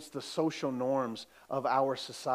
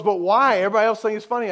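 coworker's pushback; 7 semitones higher, 9 dB louder, much faster than usual. Retold or imitated.

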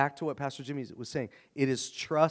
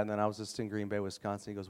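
normal talking voice

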